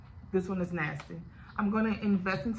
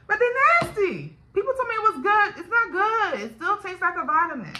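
she's fed up with how nasty they taste; exasperated; 10 semitones higher, 6 dB louder than usual. Real, not acted. Vlogger complaining to viewers about nasty vitamins, exasperated high voice.

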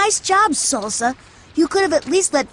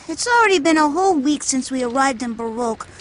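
normal talking voice

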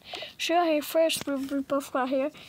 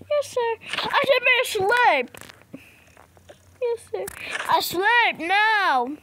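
put-on higher, meek kid voice; small child answering; scared child; 6 semitones higher than usual.

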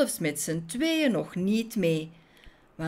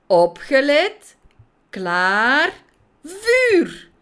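loud, slow firing orders, high on vuur; shouted command; 8 semitones higher, 9 dB louder, much slower than usual. A narrator's reading. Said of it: "gaf keer op keer het bevel".

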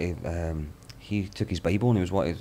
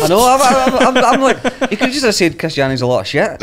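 high, loud laughing words; 10 dB louder than usual. Real, not acted. Friend laughing hard at the punchline, high and loud. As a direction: squeal through laughter.

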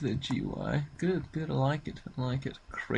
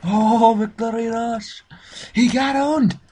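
much higher and louder victory shout; triumphant yell; 11 semitones higher, 12 dB louder than usual. Real, not acted. Gamer yelling in triumph after killing a rogue. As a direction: crow over the kill.

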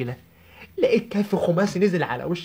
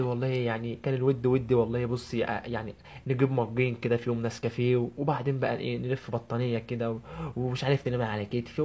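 low, softer imitation of a romancing fiancé; Turkish-film suitor; 4 semitones lower, 4 dB quieter than usual. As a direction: mimic his dreamy courtship talk, pitched down. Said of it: "فيلم تركي كده في يوم من الايام وهو بيكلمني ساعه الخطوبه". (تقليد خطيب رومانسي بتاع فيلم تركي، بصوت واطي)

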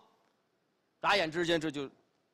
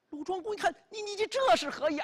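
stammering in high alarm; shocked stammer; 11 semitones higher, 3 dB louder, faster than usual. Acted. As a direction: sputter it out, startled and indignant. Lu Zhuanggong shocked at flag.

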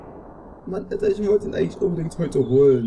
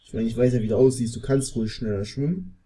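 ordinary talk

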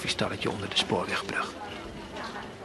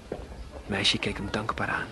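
ordinary delivery

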